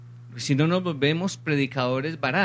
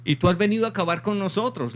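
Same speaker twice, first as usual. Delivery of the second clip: grito del poseído imitado; 7 semitones higher, faster than usual. Retold or imitated. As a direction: voice the demon's protest, pitched up. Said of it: "le grita el". (grito agudo a medias, imitado)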